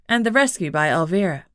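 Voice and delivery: ordinary delivery, nothing marked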